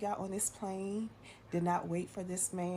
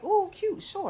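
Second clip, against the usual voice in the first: squealing high and loud; excited; 8 dB louder, slower than usual. Retold or imitated. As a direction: gush like it's adorable. Gushing yes to proposal plan.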